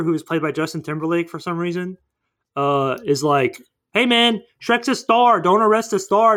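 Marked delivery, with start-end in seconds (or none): 3.9-6.4 higher, louder bluffing sidekick; lightly acted indignant appeal; mock protest. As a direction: talk the guard down, pitched up. Acted protest to guards, retold.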